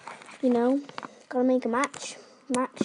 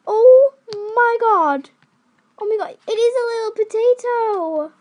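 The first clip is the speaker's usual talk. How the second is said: high, loud squealing; excited squeal; 7 semitones higher, 8 dB louder than usual. Real, not acted. Showing viewers a little potato, a high excited squeal. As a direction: gush at the tiny potato.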